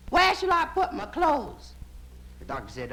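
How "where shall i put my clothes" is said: high, slow woman's voice; lady in the dark. Acted. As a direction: call out slowly in a high lady's voice. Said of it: "the voice in the dark says".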